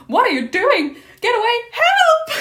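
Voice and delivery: rising panicked scream, very high and loud; cry for help. Acted. Polonius behind the curtain screaming for help, very high. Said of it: "Polonius cries for help".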